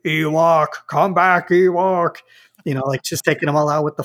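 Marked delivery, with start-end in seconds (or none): 0.1-2.2 stiff, phony robotic villain; a hint of a fake mechanical Vader. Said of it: "just going".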